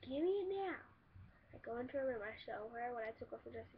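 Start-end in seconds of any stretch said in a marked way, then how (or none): 0.0-0.8 high, pushy demand; mock high sister demand. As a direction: mimic the sister demanding it back, pitched up. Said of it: "She's like".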